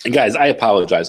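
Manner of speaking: ordinary talk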